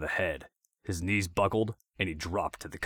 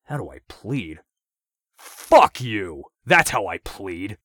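loud defiant curse, then slower; angry; 2 semitones higher, 11 dB louder, slower than usual. A narrator's reading. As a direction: spit it back at him. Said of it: "in an angry voice".

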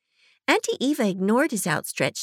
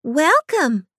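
higher, louder, bright welcome; nice librarian lady; 6 semitones higher, 5 dB louder than usual. A narrator's reading. Librarian lady welcomes newcomers, higher and sweeter. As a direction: greet them like a kindly librarian.

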